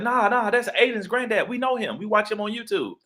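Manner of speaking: put-on high-pitched kid voice insisting; imitating kids. Kids at homecoming insist he's Aiden's granddad, high childlike voice.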